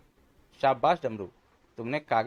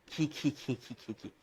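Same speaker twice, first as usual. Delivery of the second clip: giggling; 7 dB quieter, slower than usual. Acted. Reader giggles over joke book.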